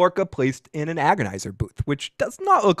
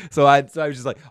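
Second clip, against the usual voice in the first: normal talking voice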